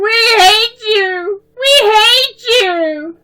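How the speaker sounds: very high, loud, slow, tearful; crying scream. A narrator's reading. Little girl screaming at the bullies, crying. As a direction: wail each word out in fury. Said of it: "Debbie screamed".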